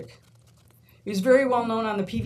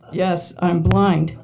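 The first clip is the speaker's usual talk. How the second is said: slow, loud, flat-out statement; deadpan reply; 3 semitones lower, 12 dB louder, much slower than usual. Retold or imitated. Blind student answering the man waving at her, slow and loud. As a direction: calmly drop the punchline.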